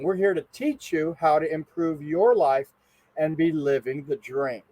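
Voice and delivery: normal talking voice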